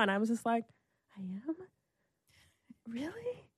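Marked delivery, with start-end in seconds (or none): 1.2-3.4 very soft, wondering questions with long pauses; awed disbelief. Retold or imitated. Answering the singer's reassurance, acted in hushed wonder. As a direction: barely dare to ask it, retold. Acts her awed reply to Erica.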